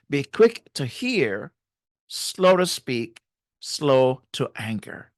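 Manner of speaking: slow, measured scripture quote; slow and deliberate. Shown as a demonstration. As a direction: draw each phrase out calmly. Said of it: "but slow it down".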